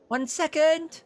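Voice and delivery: loud high call from the back room; distant call. Acted. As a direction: shout it from offstage, high and bright. Innkeeper calls from the back.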